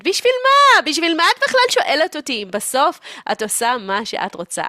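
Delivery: high, loud outburst easing off; exasperated mother. Acted. Mother fed up with daughter asking advice, exasperated. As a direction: burst out shrieky, then settle into complaint. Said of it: "אז היא אומרת".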